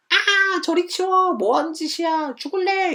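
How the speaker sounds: very high, loud screaming; panicked shriek. Shown as a demonstration. Student shrieking at a friend pushing a cicada in their face, in panic. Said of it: "이러지 말고요".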